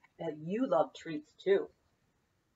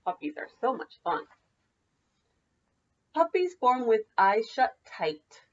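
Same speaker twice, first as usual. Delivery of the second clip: lively singsong picture-book read; bouncy read-aloud for children; 9 semitones higher, 4 dB louder than usual. Acted. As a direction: read it like story time for little kids. Teacher reads picture book aloud.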